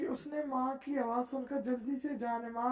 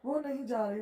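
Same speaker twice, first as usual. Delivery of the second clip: usual speaking voice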